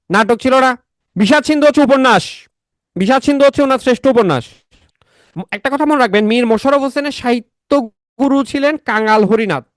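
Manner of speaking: high-pitched teacher drilling facts; emphatic lecture. Real, not acted. Quizzes class in lecture voice. (উঁচু সুরে class নেওয়া)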